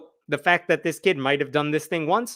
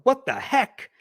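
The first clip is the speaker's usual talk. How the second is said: mock frustrated curse; 8 semitones higher than usual. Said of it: "you don't use that tone".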